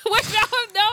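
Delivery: high, loud laughing "no, no"; laughing protest. Real, not acted. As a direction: burst out in high laughing denial.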